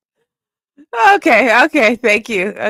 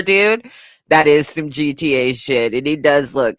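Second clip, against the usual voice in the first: ordinary delivery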